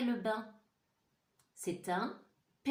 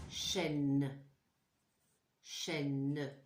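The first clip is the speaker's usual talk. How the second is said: normal talking voice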